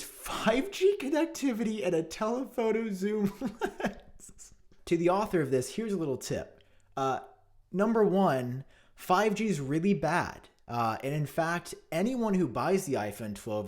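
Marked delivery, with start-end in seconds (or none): 0.0-4.5 high, slow, laughing read; amused mock read of the article. Real, not acted. 7.7-10.5 louder, pointed; scolding the article's author; emphatic callout. Real, not acted. Calling out the author.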